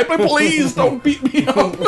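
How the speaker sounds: high, drawn-out mock sobbing plea, breaking into laughter; crying Jean-Claude Van Damme. Podcaster mimics the star pleading not to be beaten, mock crying. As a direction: beg in an exaggerated weepy whine, half laughing. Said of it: "like, crying to the guy".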